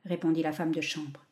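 plain reading voice

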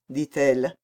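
plain reading voice

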